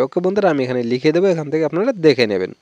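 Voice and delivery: usual speaking voice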